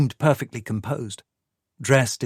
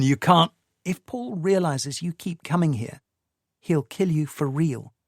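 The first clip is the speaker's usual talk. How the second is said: lightly higher, pleading woman's voice; Tamsin's voice; 6 semitones higher than usual. A narrator's reading. Tamsin pushing Michael away, a lightly voiced young woman. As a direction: voice the anxious wife lightly higher.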